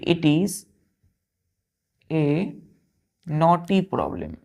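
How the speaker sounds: very slow, word by word with pauses; slow teacher dictation; slow example sentence. Shown as a demonstration. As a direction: dictate it slowly to the class. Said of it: "जैसे हमने बोला".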